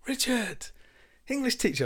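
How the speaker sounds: high, excited call of his name; bar staff greeting. Retold or imitated. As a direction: greet him like a celebrity across the bar. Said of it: "Two of the bar staff were like".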